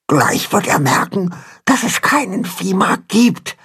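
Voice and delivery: high, anxious little voice, hushed in tone; anxious whisper. A narrator's reading. Pünktchen warns father the farmer will notice, anxiously. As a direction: confide a worry in a small high voice. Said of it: "flüstert Pünktchen ängstlich ihrem Vater zu".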